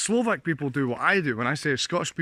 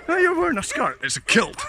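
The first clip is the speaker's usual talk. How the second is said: mock indignant correction; 14 semitones higher, 5 dB louder than usual. Mock argument about the kilt.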